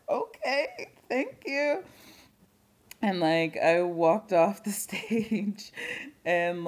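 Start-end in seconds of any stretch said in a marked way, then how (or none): 0.1-1.8 much higher, light mock-sheepish thank you; squeaky imitated thank you. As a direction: play back your own awkward little thanks, pitched way up. Retelling her own reply on stage.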